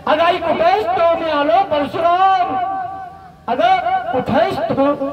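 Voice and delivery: high, searching call. Acted. Parashuram searches among the dead for his mother, calling high. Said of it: "आईला आवाज देतोय".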